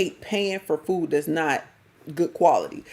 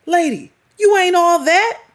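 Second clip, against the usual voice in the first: high, loud, sassy put-down; telling the lady off, loud and high with attitude; sassy callout; 9 semitones higher, 6 dB louder than usual. Real, not acted. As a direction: cut her down to size, loud and high. Calling out woman directly.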